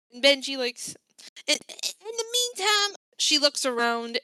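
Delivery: ordinary talk, nothing marked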